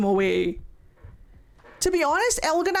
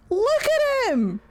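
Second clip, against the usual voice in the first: incredulous exclamation; 9 semitones higher, slower than usual. Real, not acted. Reacting to character reveal.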